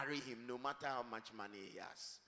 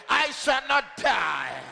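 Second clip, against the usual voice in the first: loud; 11 semitones higher, 19 dB louder, slower than usual. Shown as a demonstration. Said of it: "you are saying it weakly".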